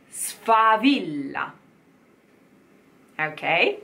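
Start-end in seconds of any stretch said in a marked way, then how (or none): 0.1-1.5 Sfavilla slowly with a long double l; slow model word. Shown as a demonstration. Coach modelling sfavilla slowly for singers. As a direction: hold the double l. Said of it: "Like my name".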